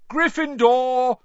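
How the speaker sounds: high, drawn-out shouted announcement; loud shout. A narrator's reading. Sorting hat announcing a house, long high shout. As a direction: shout it slowly to the hall. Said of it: "the hat shouted".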